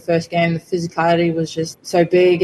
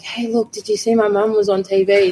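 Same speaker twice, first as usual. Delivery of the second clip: higher-pitched imitation of a child; excited kid quote; 4 semitones higher than usual. Mum quoting her kids' boast to friends, lightly higher child-like voice. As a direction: lightly mimic kids proudly telling friends. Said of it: "and they're like".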